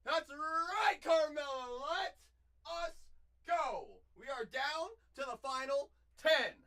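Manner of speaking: loud, high hype yell; excited shout. Real, not acted. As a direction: cheer it out. Celebrating pick.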